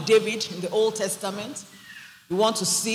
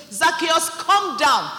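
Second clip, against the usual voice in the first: higher, louder call up the tree; 7 semitones higher, 5 dB louder, slower than usual. Retold or imitated. Jesus calling Zacchaeus down from the tree, shouting. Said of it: "he cried when Zacchaeus".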